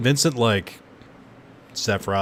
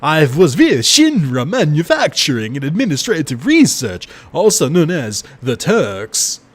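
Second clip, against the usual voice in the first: louder, higher, slow brooding Vincent read; 7 semitones higher, 8 dB louder than usual. Acted. Streamer voicing Vincent from his coffin, louder brooding delivery. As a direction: intone it darkly like a cursed gunman. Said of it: "this is the perfect accent".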